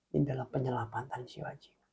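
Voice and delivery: hushed prayerful whisper. Real, not acted. Jim vows to God to save souls, whispered. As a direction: whisper the vow.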